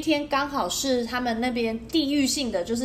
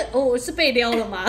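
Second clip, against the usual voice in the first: ordinary delivery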